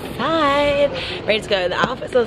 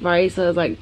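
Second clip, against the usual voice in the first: normal talking voice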